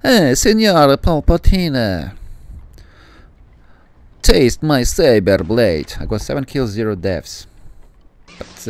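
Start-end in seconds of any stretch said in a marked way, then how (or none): 0.0-5.9 slow, hammed-up mock Italian accent, pitched higher; playful Italian accent taunting an enemy. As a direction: taunt him in a jokey stage-Italian accent. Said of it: "He sounds like Italian".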